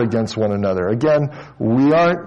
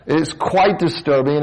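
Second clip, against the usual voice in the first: ordinary talk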